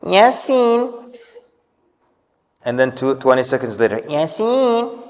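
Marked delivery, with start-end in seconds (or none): 0.0-1.2 mock calling out, much higher pitch; nervous mother calling her son Yasin, imitated high; mother calling child. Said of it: "She goes". 4.0-5.0 mock calling out, much higher pitch; nervous mother calling her son Yasin, imitated high; mother calling child. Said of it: "She goes".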